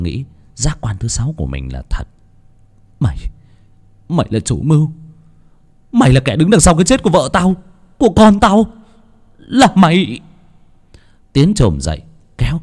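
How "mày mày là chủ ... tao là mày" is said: high, loud, fast accusing yell; furious shout. A narrator's reading. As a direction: scream the accusation at the killer. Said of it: "Tiến tức giận hét lên".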